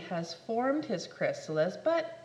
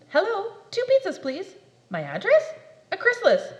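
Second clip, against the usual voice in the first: pizza phone order; 8 semitones higher, 8 dB louder than usual. Acted.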